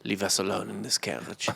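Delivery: low, soft sultry murmur; a breathy come-on, almost whispered; hushed seductive Napoleon. Retold or imitated. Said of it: "Napoleon would have been like".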